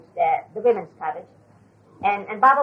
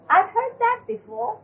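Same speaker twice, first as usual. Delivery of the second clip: teasing complaint; 11 semitones higher than usual. Retold or imitated. Baba objects to repeated joke.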